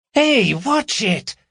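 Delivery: high, slow indignant protest; complaining. Acted. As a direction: push back at the torch in her face. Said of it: "she complained".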